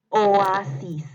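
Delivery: projected, drawn-out "Oasis"; dictation. Shown as a demonstration. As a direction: run the long o into a in "Oasis", loudly. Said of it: "les voy a ir dictando". (dictado fuerte, alargando "Oasis")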